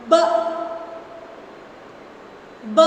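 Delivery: B said alone, crisp and separate; isolated B sound. Shown as a demonstration. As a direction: sound out just the letter B. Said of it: "I will tell a ending sound".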